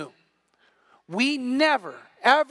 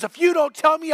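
higher, louder imitated threat from the big friend; mock threat; 6 semitones higher, 4 dB louder than usual. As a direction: lean on him like a lineman.

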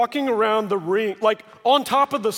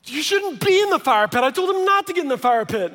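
higher, urgent disbelief; alarmed dad; 9 semitones higher, 3 dB louder than usual. Retold or imitated. Dad hearing his toddler is in the fire pit, alarmed. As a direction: react like a startled father. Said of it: "And so I'm like".

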